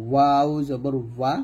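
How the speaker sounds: ওয়াও drawn out with both lips rounded; rounded-lip articulation. Shown as a demonstration. Teacher showing students the letter, ওয়াও slow and round-lipped. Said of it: "ওয়াওটা দুই ঠোঁট গোল করে".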